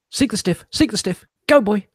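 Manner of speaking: calling a dog. Pretend.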